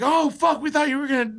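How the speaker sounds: high, startled exclaim; imitating the friend's relief; relieved shock. Friend sees Scotty is back, a high startled exclaim.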